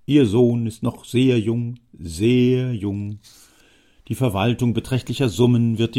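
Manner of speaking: put-on old Jammer, insistent. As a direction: lean in and press your case as the old schemer. Jammer speaks to the father.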